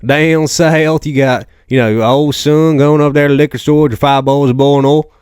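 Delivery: big exaggerated down-south accent; Southern accent. Shown as a demonstration. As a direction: put on a hammed-up Southern US accent. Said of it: "you got your down south".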